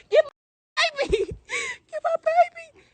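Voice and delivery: very high shriek with a laugh; startled cry and laugh, high; panicked laughing shriek. Real, not acted. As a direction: squeal as the animal grabs.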